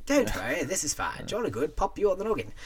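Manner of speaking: mock British stewardess soothing kids, pitched high; hammed-up posh British stewardess. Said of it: "the stewardess be like".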